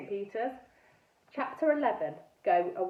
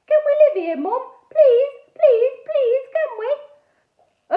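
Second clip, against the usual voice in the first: very high, loud whiny begging; squeaky pestering; 12 dB louder, much slower than usual. A narrator's reading. Squeaky girl pestering her mum to stay. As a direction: whine and beg, drawing out the pleases. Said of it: "pestered her".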